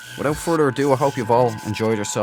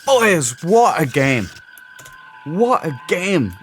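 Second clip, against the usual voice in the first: loud, higher burst of admiration; thrilled praise; 5 semitones higher, 4 dB louder, much slower than usual. Real, not acted. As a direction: gush to viewers about a great game. Player praises the finished game.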